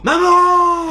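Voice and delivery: loud, high call across the house; yelling for mom. Acted. Kid yelling for mom from his room, calling out loud.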